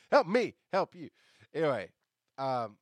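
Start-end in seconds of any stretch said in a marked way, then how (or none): 0.1-1.1 loud movie quote. Retold or imitated. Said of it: "Show me the money, Jerry".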